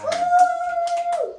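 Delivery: high, loud cheering woo; excited whoop. Real, not acted. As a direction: cheer for the guest. Cheering after guest intro.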